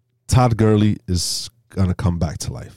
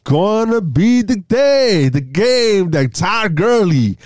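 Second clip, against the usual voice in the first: high, slow, grand proclamation; over-dramatic hype, drawn out and high; hammed-up build-up; 8 semitones higher, slower than usual. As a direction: stretch it out like a momentous announcement. Overdoes delivery after anticlimactic remark.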